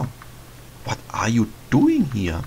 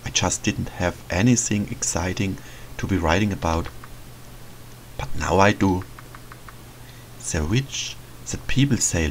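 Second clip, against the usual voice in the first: softer, quieter inner voice reading a diary; diary narration; 3 semitones lower, 4 dB quieter than usual. Acted. The boy reads his diary entry quietly to himself. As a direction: read it like a private journal entry.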